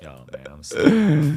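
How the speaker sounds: hammed-up street voice. Shown as a demonstration. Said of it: "Just do your whole thing".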